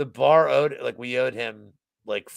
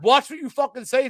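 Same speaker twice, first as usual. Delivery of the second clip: high, louder threat; retold angry warning; 13 semitones higher, 5 dB louder than usual. Mocked customer threatening the manager, high and loud. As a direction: warn him off with raised voice.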